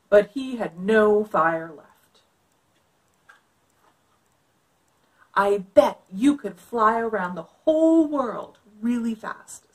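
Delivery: put-on lively read-aloud for kids; story narration. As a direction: read it like a picture book. Reads picture book aloud.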